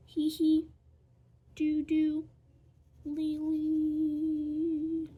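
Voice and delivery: loud, high-pitched nonsense syllables; silly high noises. Pretend. Vlogger making noises while drawing, silly and high. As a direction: squeal goofy little sound effects on one high note.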